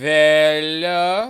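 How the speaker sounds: put-on loud, rising French-accented word. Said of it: "focused on this accent".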